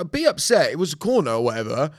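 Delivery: put-on dad scolding another dad at kids' football; retold telling-off. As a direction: lecture the heckler again in the retelling. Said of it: "I was like".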